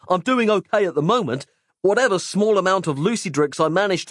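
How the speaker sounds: high-pitched, fretful injured man; anxious refusal. A narrator's reading. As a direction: explain anxiously, pitch held up throughout. Injured character anxiously refusing the pills.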